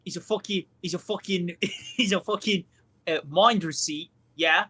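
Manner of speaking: mock British accent, tagging yeah; put-on British accent. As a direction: play a cheeky cockney. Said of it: "Why did you say man like that?".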